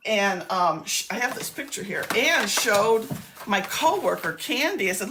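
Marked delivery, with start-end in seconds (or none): none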